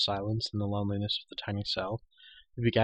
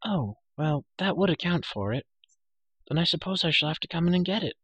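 put-on light, higher girlish voice; a young girl speaking; 7 semitones higher than usual. A narrator's reading. Elenwy's lines in story.

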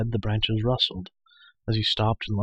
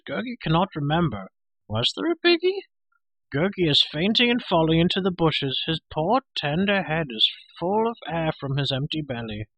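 high-pitched, melodramatic creature whine; whining Gurgi; 7 semitones higher than usual. Acted. Hungry creature dodging the pig question, whining. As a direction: feign forgetfulness, whining high and swooping. Gurgi stalling, pleading hunger.

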